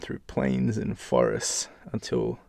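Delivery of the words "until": usual speaking voice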